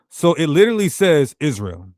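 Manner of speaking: normal talking voice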